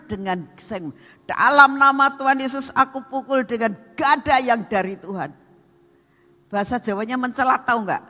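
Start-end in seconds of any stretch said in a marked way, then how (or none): 1.3-5.3 higher, louder proclamation in Jesus' name; loud declaration. Acted. Pastor proclaiming over the stone, commanding and loud. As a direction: strike with the voice like a club. Declaring the strike with God's club.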